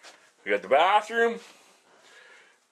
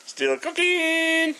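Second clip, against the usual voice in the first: high, slow sing-song aside; 13 semitones higher, much slower than usual. Acted. Cook announcing the food is still cooking, high sing-song. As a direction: sing it out lightly, stretched.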